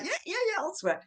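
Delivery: high, keen yeah yeah yeah; imitating a keen student; eager volunteer. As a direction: jump at the chance. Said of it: "He was like".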